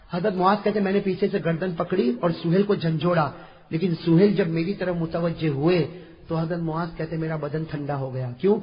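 ordinary delivery

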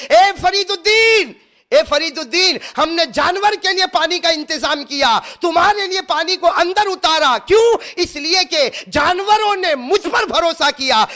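high, loud booming divine call; voice of God. Acted. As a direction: proclaim like a voice from the unseen. Voice of God answering.

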